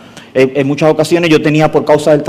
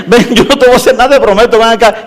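mock panting plea; 5 semitones higher than usual. Pursuer begs the woman to come back, mock panting. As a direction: plead while acting out of breath.

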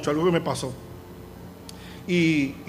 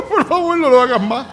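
mock crying child; 6 semitones higher, 4 dB louder than usual. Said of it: "sale llorando".

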